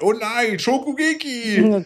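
hoch und langgezogen, übertriebene Anime-Stimme; overacted anime dub. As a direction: wie eine dramatische Anime-Figur schreien. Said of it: "wie Anime-Püppchen gegenseitig Essen kochen". (übertriebene Anime-Synchronstimme)

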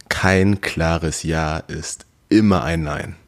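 slow and deliberate, every word weighed; emphatic statement. Real, not acted. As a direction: spell out "Kein klares Ja ist immer ein Nein" slowly, word by word. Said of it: "aber ich will's nochmal betonen".

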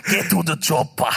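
put-on Austrian-accented action-star command; Arnold. Host quotes Arnold for the audience, Austrian-accented impression. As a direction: bark it in thick Austrian English. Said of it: "Wie redet ein Arnold?".

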